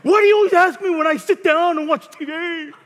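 loud, high sulky whine; hammed-up childish complaint; whiny child. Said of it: "respond like a little child".

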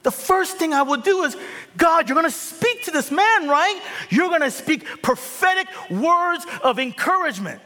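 mock zealous minister; hammed-up eager pastor. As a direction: play the eager pastor who knows best. Pastor acting eager encourager.

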